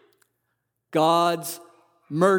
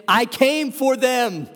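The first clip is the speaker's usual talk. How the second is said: higher, louder and slow, each word landing; emphatic declaration; 6 semitones higher, 4 dB louder, slower than usual. Acted. Jesus declaring to the critics, emphatic and slow. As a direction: proclaim it as Jesus, firm and sure.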